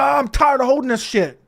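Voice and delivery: mock a rude, impatient complainer; shitty attitude. Man behind in the grocery line complains, shitty attitude. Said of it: "Says it real shitty".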